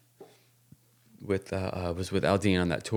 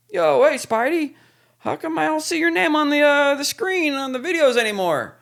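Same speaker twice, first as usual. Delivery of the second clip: high, louder mock [name] voice; imitating Luke; 5 dB louder than usual. Retelling Luke asking why his name vanished, in a high mock voice. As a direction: squeak it out like a teasing buddy. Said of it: "Luke goes".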